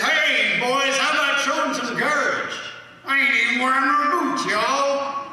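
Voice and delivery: high, loud call across the water; retold yelling. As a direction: yell out to the boys, teasing. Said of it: "he started yelling".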